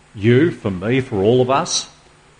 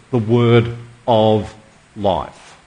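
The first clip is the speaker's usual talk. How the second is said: the word of life stretched slowly, each word weighted; drawn-out quote; 3 semitones lower, much slower than usual. Shown as a demonstration. Preacher repeating John's phrase to the congregation, slow and weighted. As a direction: linger on every word. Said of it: "why would John use that expression".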